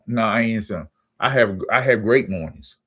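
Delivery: ordinary talk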